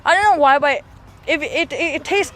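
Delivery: talking while tasting something sour; words around a sour bite; eating sour candy. Real, not acted. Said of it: "it's so sour".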